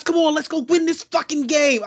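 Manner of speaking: high, loud mock team pep talk; mock rallying voice. Quarterback rallying teammates to win, mock high-pitched shout. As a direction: imitate an uncommanding leader rallying the team. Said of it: "his voice, A, does not command".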